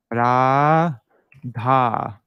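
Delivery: ra and dha each stretched long; long syllables. Shown as a demonstration. Teacher showing two long syllables. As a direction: hold the vowel of ra and dha. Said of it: "Those are two long syllables".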